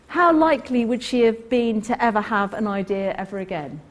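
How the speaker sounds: usual speaking voice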